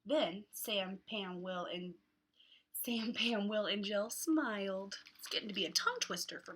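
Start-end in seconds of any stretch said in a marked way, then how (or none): none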